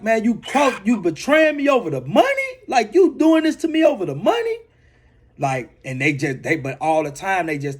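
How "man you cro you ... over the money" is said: demanding how family could do this; betrayed disbelief. Retold or imitated. To his uncle over the ransom money, hurt disbelieving cry.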